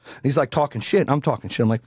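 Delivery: ordinary delivery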